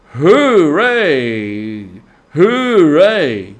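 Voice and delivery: loud, ringing hoorays; delighted cheering. A narrator's reading. Rat cheering over his find in the snow, loud and delighted. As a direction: shout it twice for joy. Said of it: "Suddenly the rat cried".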